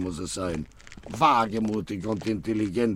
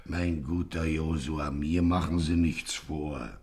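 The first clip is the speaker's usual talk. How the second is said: menacing; 6 semitones lower than usual. Acted. Gangster menaces host.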